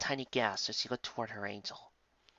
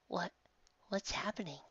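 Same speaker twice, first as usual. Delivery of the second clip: put-on little girl voice; 8 semitones higher, 8 dB quieter than usual. Girl confused by angel.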